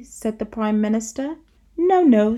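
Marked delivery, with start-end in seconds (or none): 1.8-2.4 put-on much higher, louder child's protest; bossy little princess. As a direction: squeal a stubborn no. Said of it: "said the little princess".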